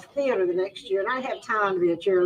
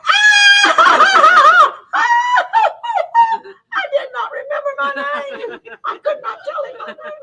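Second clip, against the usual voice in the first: very high, loud shriek, then words through laughter; laughing shriek; 11 dB louder, slower than usual. Real, not acted. Laughing shriek as she forgets her name before the president.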